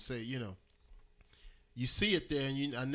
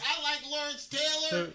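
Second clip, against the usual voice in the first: mock fan voice; 14 semitones higher than usual. Mocking a fair-weather fan.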